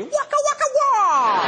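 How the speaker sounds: high wailing waka waka wah; trumpet wah-wah noise. Retold or imitated. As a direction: wail it like a sad horn. Comic sound after [name] quote.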